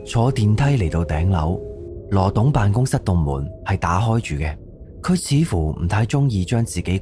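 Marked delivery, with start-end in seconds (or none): none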